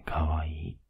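tender whisper. Acted. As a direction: whisper it close. Hugging girlfriend.

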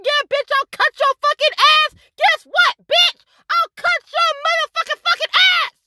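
replaying the threat she made, high and cutting; retold threat. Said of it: "When I told that motherfucker".

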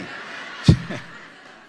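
breathy burst of laughter. Real, not acted. Preacher teases wives saying amen, breathy laugh. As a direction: laugh at your own joke.

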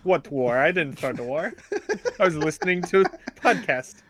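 mock innocent fan in a put-on voice; imagined Russian listener. Co-host plays Russian fan denying the war, mock-innocent voice. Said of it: "he's just sitting there like".

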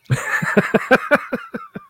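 a burst of high laughter at his own joke. Real, not acted. Host laughing at his butcher joke, high laugh. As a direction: let a high laugh break out.

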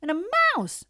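high, loud cub squeal; excited little-fox scream. A narrator's reading. Two little foxes interrupting father, screaming. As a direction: scream it like eager cubs. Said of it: "screamed two of the little foxes".

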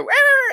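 high-pitched imitated screech; mock rat scream. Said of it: "he's even screaming".

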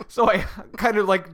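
normal talking voice

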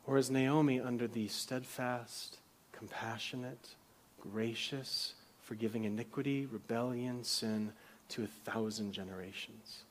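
hushed question. Real, not acted. Preacher quietly asking the congregation about Naomi's fate, hushed. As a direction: let the question sink in quietly.

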